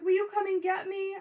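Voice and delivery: high, loud retold plea; imitated bawling daughter. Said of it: "she calls me and she's bawling".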